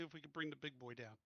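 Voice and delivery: ordinary talk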